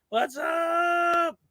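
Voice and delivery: high-pitched cheerful what's up; excited greeting. Real, not acted. Chat shout-out greeting.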